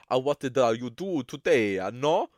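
lightly voiced cop questioning; police interrogator impression. Retelling police interviewing the suspect, light mock accent. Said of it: "it's not in an Italian accent".

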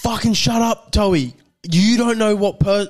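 high, mock-angry retort; imitated angry snap. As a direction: act out a mate losing his temper. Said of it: "Maz is getting fully angry".

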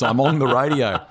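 over-articulate it for someone in the next car; exaggerated mouthing. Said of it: "He sort of mouths the words".

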